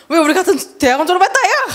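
fast, high-pitched mocking laughter; scornful laughing. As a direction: jeer incredulously while cracking up. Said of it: "막 비웃던데". (깔깔대며 빠르게 비웃듯)

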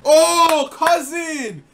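high, loud fake realization; mock recognition. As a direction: ham up sudden recognition. Pretends to recognize the stranger.

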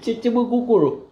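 silly high singsong; playful nonsense call. Real, not acted. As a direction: squeal it to make him laugh. Nonsense sound to amuse baby.

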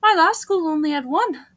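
animated wonder, lifting on questions; lightly voiced heroine. Newcomer amazed by the huge sports fields, animated. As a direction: marvel brightly at each new sight.

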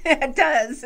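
amused agreement through a laugh. Real, not acted. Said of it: "laughs at the robot line".

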